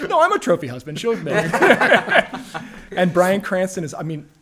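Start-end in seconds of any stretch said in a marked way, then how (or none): 0.0-2.9 laughing. Real, not acted. Joking about being arm candy.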